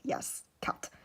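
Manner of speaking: hushed, unvoiced whisper; whispered delight. Imagining getting cats from a friend, whispered delight. Quotes own delighted reaction.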